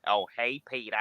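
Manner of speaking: Cleveland voice. Pretend. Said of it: "I can do Cleveland".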